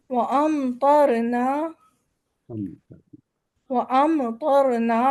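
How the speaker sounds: وَأَمْطَرْنَا meem sounded clearly before ط; izhar of meem. Shown as a demonstration. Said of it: "میم کو بس اظہار کر کے".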